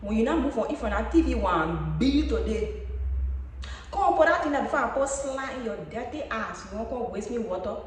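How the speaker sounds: loud Liberian Grebo accent. Shown as a demonstration. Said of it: "Let me see if I still got my Grebo accent".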